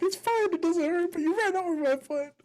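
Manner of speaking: high-pitched, indignant imitation; mock pissed off. Imitating little brother complaining to driver, mock pissed off. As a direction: whine it up high like an aggrieved kid. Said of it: "He's all pissed off".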